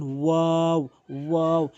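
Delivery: ওয়াও drawn out from rounded, empty mouth; open-mouth waw. Shown as a demonstration. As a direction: stretch each ওয়াও through the open space of the mouth. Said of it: "এভাবে উচ্চারণ করবেন". (ওয়াও মুখের খালি জায়গা থেকে টেনে)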